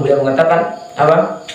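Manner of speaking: ordinary talk, nothing marked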